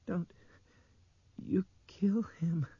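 put-on high, whispery, very slow child's voice; effortful speech. A narrator's reading. Injured girl pleading for man's life, slow whispered effort. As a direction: squeeze out each word haltingly, with great effort. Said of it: "fantastic effort she was making to speak".

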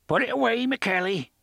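put-on high, loud, thick-accented pirate. A narrator's reading. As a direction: growl it out in a heavy brogue. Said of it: "in an accent so thick that the sense of his words was almost lost".

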